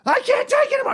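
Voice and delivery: high, strained cry of panic; freaking out. Retold or imitated. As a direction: break down, voice cracking high. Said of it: "I'm just freaking out".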